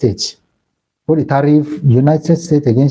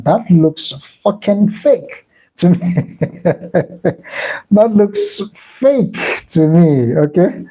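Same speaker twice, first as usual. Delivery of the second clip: laughing; 4 semitones higher, much slower than usual. Real, not acted. Laughing at own accent attempt.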